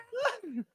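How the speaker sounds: high squeaky laugh still in the bird voice; real laugh in falsetto. Real, not acted. Laughing after bird impression.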